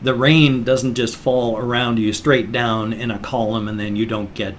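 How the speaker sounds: ordinary talk, nothing marked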